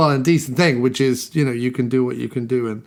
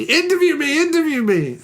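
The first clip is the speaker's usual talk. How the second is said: high-pitched, comic "pick me" plea; mock eager clamour; 13 semitones higher than usual. Host joking that listeners will want to interview him, mock eager clamour. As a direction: squeal it much higher, half joking.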